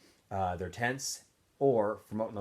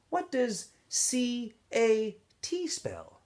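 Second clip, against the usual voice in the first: slow quiz; 13 semitones higher, much slower than usual. A narrator's reading. Warden quizzes Zero slowly.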